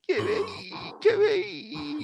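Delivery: high mewing cat noise; cat call imitation. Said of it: "言うてね".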